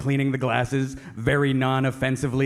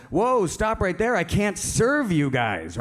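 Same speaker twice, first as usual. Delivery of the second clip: slightly hammed-up halting call, slow and higher; bartender refusing service; 7 semitones higher, slower than usual. Bartender refuses the three customers, slow mock-firm halt. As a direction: draw it out, lightly playing a firm barkeep. Bartender halts the trio again.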